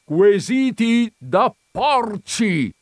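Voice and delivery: high, loud, slow outraged cry; ranting. A narrator's reading. Visitor throwing her phrase at the countess, ranting. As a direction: hurl each word out in scandal. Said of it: "strepitò il visitatore".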